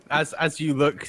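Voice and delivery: ordinary delivery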